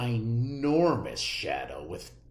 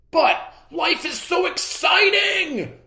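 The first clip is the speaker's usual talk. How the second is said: much higher, louder gleeful shout; excited shout; 7 dB louder than usual. A narrator's reading. Clark to his teacher, shouting happily. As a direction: burst out with joy.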